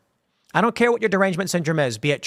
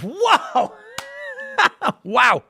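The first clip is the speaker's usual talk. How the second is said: high squeal of amazement then a laugh; surprised laugh; 14 semitones higher than usual. Real, not acted. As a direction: caught off guard, laugh it out. Amazed by viewer's family question.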